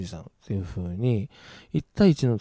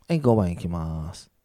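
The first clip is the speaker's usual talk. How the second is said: ordinary talk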